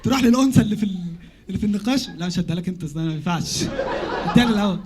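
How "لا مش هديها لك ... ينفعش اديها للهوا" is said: flower seller voice. Acted. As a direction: play the pushy rose seller.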